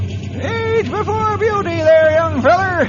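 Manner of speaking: put-on a cranky old-timer teasing as he helps Henry aboard; Stumpy's voice.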